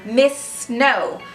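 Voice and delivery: ordinary delivery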